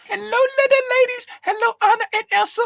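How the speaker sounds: very high, fast sugary hello; sweet princess greeting. Pretend. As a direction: greet the little girls sweetly.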